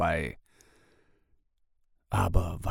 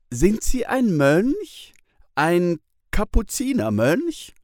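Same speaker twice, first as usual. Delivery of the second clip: put-on a wide-eyed little girl, higher and unhurried; curious young girl, pitched up; girl Tina; 10 semitones higher, 4 dB louder than usual. A narrator's reading. Girl Tina asks the man.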